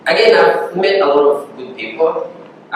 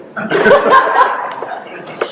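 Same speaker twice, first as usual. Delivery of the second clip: burst of laughter. Real, not acted. Laughs at own joke.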